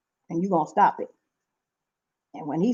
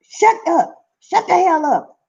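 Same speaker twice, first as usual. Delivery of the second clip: exasperated telling off; 9 semitones higher than usual. Real, not acted. Telling off people who are mean to mothers.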